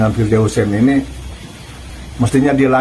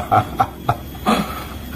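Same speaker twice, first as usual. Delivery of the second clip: mocking laugh; 3 semitones lower, 7 dB quieter than usual. Real, not acted. Commentator mocks the leaked recording.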